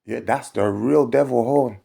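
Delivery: ordinary talk, nothing marked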